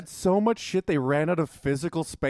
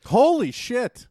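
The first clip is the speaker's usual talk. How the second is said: slow, high astonished exclamation; drawn-out stunned holy shit; shocked; 9 semitones higher, 6 dB louder, much slower than usual. Real, not acted. Shocked at 180 years.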